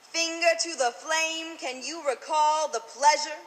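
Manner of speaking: rhythmic slam poem delivery; spoken word poetry. Acted. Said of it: "I have written an original slam poem".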